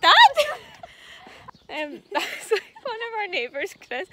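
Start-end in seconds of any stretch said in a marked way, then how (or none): 0.2-1.6 loud, high burst of laughter. Real, not acted. Neighbour then Dad appear.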